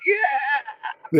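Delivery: high laughing yeah; laughing cheer. Retold or imitated. Soldiers reacting to the colonel's praise, high laughing yeah. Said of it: "we're like, going".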